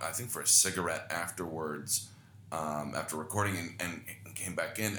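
usual speaking voice

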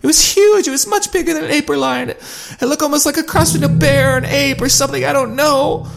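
very high, shaken voice; frightened. A narrator's reading. Allie describing the creature, frightened. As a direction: describe it still terrified.

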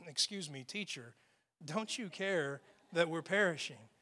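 a dainty, far too polite request; mock polite. Shown as a demonstration. Preacher mocks a polite disciple, hushed and meek. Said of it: "they didn't walk up to him and say".

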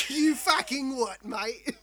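slow, loud mock Australian snarl. Friend mocking an Australian officer, slow Australian. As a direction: ham up the Aussie outrage.

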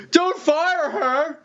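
high, slow, drawn-out jeering call; mock press heckler. Retelling a reporter's jab at Obama, high and slow. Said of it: "some asshole in the press was like".

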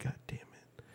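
unvoiced hissed curse; whispered curse. Cursing to himself as visitors arrive, whispered. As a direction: curse under the breath.

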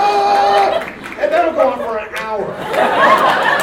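drawn-out, loud, high held yell; long loud held shout. Shown as a demonstration. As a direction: hold one loud note as long as possible. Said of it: "I would have kept that up".

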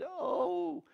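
a high, loud startled oh; high shocked oh at the enemy army; shocked gasp. Acted. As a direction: gasp in sudden alarm. Servant sees the army.

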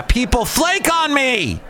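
high exasperated cry about flaky staff; frustrated outburst. Real, not acted. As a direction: vent about people flaking, voice jumping up. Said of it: "That was loud".

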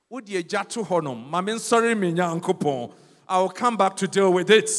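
rising to a loud rebuke; telling off the accuser. Acted. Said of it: "we have to say that".

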